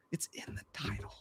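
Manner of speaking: very soft, unvoiced-sounding hush; exasperated whisper. Real, not acted. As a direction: whisper it pointedly. Hushed repeat to correcting viewer.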